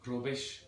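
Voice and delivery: "Rubbish" with a light Scouse trill; slightly rolled R. Shown as a demonstration. Teacher demonstrating Liverpool accent, "Rubbish" with slight R roll. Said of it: "a slight roll on the R's".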